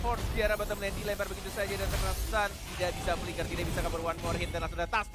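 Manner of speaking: loud play-by-play yell; fighting game announcer. Acted. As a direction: punch out the kill call. Caster calls a bottom-lane kill.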